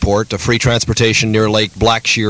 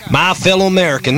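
echoed mock presidential address, pitched up; Richard Nixon; 8 semitones higher than usual. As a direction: mimic a president opening a speech. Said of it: "just the way I used to sound".

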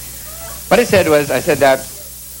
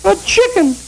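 much higher indignant wife; outraged wife; 8 semitones higher than usual. Acted. Wife finding husband with chicken, high and indignant. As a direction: shriek it like a betrayed wife.

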